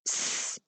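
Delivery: S as a long unvoiced hiss, no vowel; S sound demo. Shown as a demonstration. Teacher showing children the sound of S as a hiss. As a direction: hiss the S like a snake. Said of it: "makes sound S".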